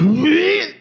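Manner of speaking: high squealed "wheet"; imitated wheezing squeal. Comedian imitating his wife winded after falling, high squeal. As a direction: squeal it upward like air knocked out. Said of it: "I heard".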